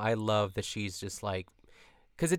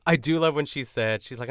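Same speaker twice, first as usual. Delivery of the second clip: usual speaking voice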